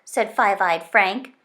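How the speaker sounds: plain reading voice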